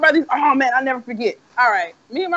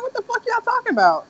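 put-on confused kid; 13 semitones higher than usual. Confused young self.

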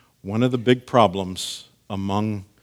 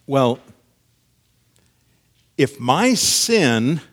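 high, loud, drawn-out mock reasoning; mock objector's tone; 5 semitones higher, 7 dB louder than usual. Preacher voicing objectors who twist grace, exaggerated mock-logical tone. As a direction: stretch the words and swing the pitch up, arguing smugly. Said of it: "they wouldn't use that tone of voice".

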